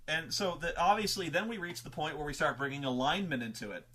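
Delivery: usual speaking voice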